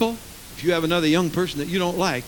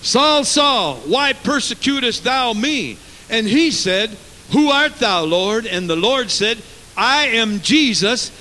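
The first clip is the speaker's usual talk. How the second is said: raised, declaimed Bible dialogue; forceful scripture preaching; 6 semitones higher, 7 dB louder, slower than usual. Acted. Preacher declaiming Saul's encounter with Jesus, forceful and raised. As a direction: proclaim it slowly with high, punched openings.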